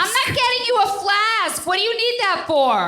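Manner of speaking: scolding mom. Acted. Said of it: "stand up for this scene for read".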